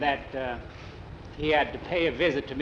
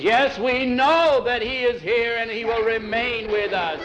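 exasperated pulpit proclamation; disturbed reverend; 7 semitones higher, 4 dB louder than usual. A narrator's reading. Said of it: "Reverend was getting quite disturbed".

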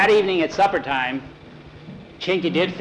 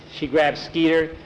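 plain reading voice

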